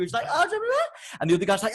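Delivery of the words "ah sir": high-pitched mock-Hebrew cry; man ranting in Hebrew. Neighbour in the cubicle answering back, high mock-Hebrew cry. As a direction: imitate a foreign back-and-forth rant. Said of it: "speaking to the other guy in Hebrew".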